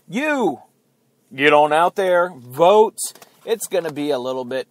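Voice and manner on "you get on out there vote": rallying call. Real, not acted. Urging viewers to vote.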